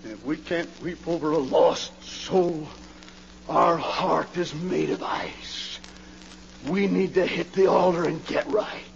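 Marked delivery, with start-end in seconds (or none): 1.4-8.8 hushed, breathless appeal; urgent whisper. Real, not acted. Preacher urges his congregation to the altar, whispering. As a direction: whisper it like a confession.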